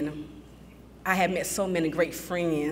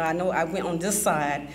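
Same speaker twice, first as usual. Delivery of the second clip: ordinary talk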